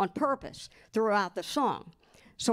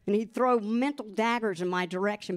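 ordinary talk, nothing marked

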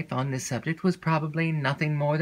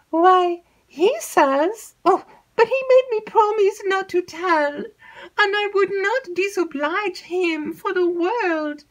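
put-on high, breathless chatter of an excitable young maid; alarmed lady's maid spilling gossip; 4 dB louder, slower than usual. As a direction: gabble it like a nervous servant girl. Annette hedges.

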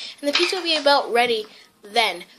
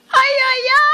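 high, loud Italian-style cry; strong Italian voice; 11 semitones higher, 8 dB louder than usual. Acted. Mom screaming out loud, strong Italian voice. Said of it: "my mom is talking in a really strong Italian voice".

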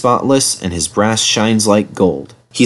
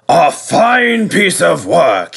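slow, higher-pitched ceremonious praise; Fat Controller; 7 semitones higher, much slower than usual. A narrator's reading. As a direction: pronounce approval grandly, drawing it out.

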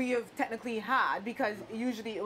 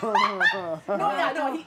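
normal talking voice

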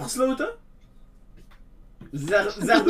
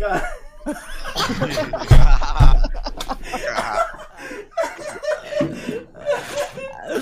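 long, high, loud cackling fit; shrieking, near-crying laughter; hysterical laughter; 13 semitones higher, 5 dB louder than usual. Real, not acted. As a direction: laugh until it turns to a squeal. Cracking up at own joke.

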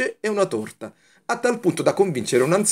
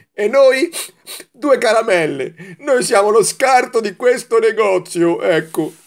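put-on high, tearful child voice, whining about the shop; a small sweet on the verge of tears, voice pitched up; high-pitched whiny lament of a slighted candy; tearful little candy; 8 semitones higher, 5 dB louder than usual. A narrator's reading. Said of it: "rispose con le lacrime negli occhi".

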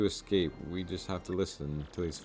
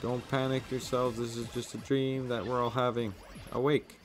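higher, frantic crew voices; hammed-up panic; 6 semitones higher than usual. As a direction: scream like ghosts are boarding.

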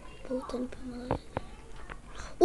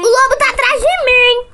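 higher, louder urgent call; being chased; 7 semitones higher, 8 dB louder than usual. Real, not acted. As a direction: warn them while fleeing. Wolf chasing her. (sendo perseguida)

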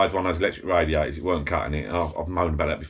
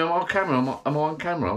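wife asking; 7 semitones higher, much faster than usual. Retold or imitated. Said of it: "she says to me".

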